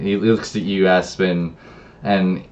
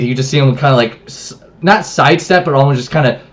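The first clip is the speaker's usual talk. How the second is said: ordinary talk, nothing marked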